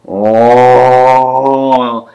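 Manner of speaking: one long sustained undead groan; drawn-out moan of the horde; zombie moan. Retold or imitated. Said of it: "you hear more moans".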